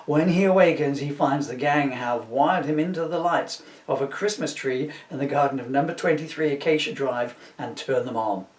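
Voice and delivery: usual speaking voice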